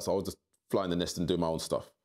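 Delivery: ordinary delivery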